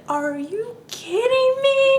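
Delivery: high, slow squeal of disbelief; mock outrage. Imitating the lady, slow high squeal of outrage. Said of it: "And the lady was like".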